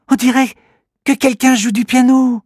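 put-on high child voice, suddenly alert; a girl catching a strange sound; surprised little girl. Alori hearing the piano, surprised little girl voice. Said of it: "dit soudain Alori".

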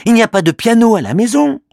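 put-on high child voice, rattled off fast; quick dismissive boy. Manu insisting there's no piano at home, fast boy voice. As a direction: hurry through it, sure of yourself. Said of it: "répond Manu".

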